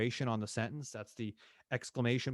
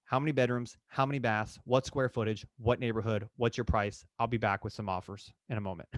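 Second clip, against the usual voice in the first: louder, brisk rapid-fire questions; rushed checklist agent; 4 dB louder than usual. Retold or imitated. Mocking a realtor rushing a consultation, louder. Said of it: "watched realtor consultations".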